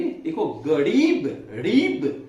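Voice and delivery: गरीब said slow and loud, रीब leaned on; stressed long ee. Shown as a demonstration. Teacher dictating गरीब to pupils, long री stressed. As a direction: stretch the री in गरीब. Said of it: "जोर लग रहा है इसमें".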